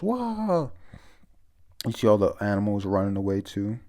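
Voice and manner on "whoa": high startled whoa. Retold or imitated. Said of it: "He goes".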